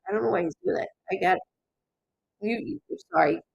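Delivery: usual speaking voice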